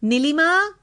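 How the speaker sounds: high, rising call of a name. A narrator's reading. Sudhakar calls his wife from the garage, calling out. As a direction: call through the house for someone. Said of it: "સુધાકરે બૂમ મારી".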